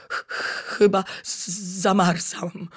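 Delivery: a freezing little bat stuttering out each word; frozen bat stuttering ch-, chyba z-, zamarzam; stammering. Acted. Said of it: "wyjąkał Gacuś".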